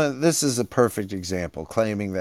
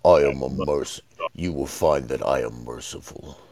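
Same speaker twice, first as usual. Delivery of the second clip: dark lord; 9 semitones lower, 3 dB quieter than usual. Retold or imitated. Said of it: "and he's like".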